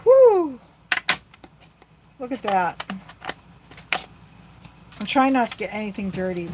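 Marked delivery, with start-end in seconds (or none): 0.0-0.6 relieved whoop. Real, not acted. Release after holding breath.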